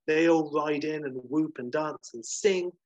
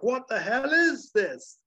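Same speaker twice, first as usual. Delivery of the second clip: high, loud, drawn-out exclamation; outraged bewilderment; 10 semitones higher, 6 dB louder, slower than usual. Acted. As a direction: explode in hammed-up disbelief at the chaos. Boss faced with sudden chaos in office.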